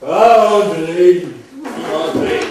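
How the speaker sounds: drawn-out, loud call of a name. Acted. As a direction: hail someone by name, stretching it. Calling out to André. (appel fort et traînant)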